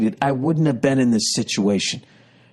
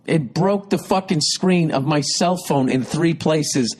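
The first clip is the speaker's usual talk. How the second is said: normal talking voice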